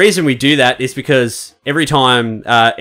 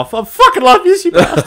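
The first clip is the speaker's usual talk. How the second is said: emotional declaration; 3 dB louder than usual. Real, not acted. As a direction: voice climbing high with emotion. Heartfelt toast to mates.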